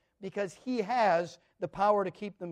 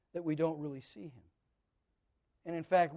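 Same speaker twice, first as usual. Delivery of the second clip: ordinary talk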